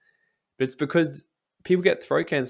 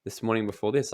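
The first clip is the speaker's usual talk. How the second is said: normal talking voice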